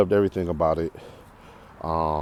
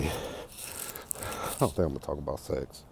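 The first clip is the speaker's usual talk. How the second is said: much softer and slower, almost under the breath; a slow, much quieter aside, half-laughing at the idea; hushed aside; 4 semitones lower, 11 dB quieter, slower than usual. Real, not acted. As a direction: drop it like a sheepish confession to yourself. Shy aside about a taboo topic.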